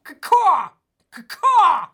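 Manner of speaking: loud high caw; masculine crow caw. Retold or imitated. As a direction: caw like a big male crow. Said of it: "a little bit more masculine in its caw-caw".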